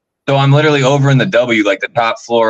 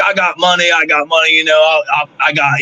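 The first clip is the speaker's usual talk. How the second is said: higher-pitched imitation Australian; Australian accent; 7 semitones higher than usual. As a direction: put on an Australian bloke's accent. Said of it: "he's got this Australian accent".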